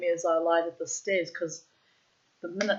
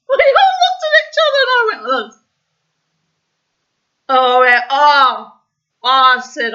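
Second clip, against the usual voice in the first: high, loud words through helpless laughter; can barely talk for laughing; crying with laughter; 7 semitones higher, 16 dB louder than usual. Real, not acted. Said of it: "We were crying with laughter".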